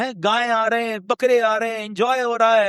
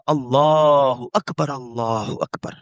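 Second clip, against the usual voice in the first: heavenly call; 5 semitones lower, much slower than usual. Acted. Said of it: "اوپر سے آواز آئی".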